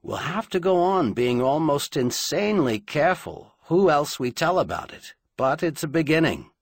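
Charleon, earnest. A narrator's reading. Speaking of who can be told, earnestly.

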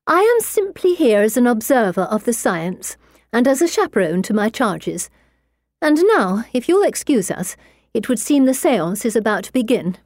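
a prim lady bristling at him; indignant lady. Acted. Lady character voiced higher.

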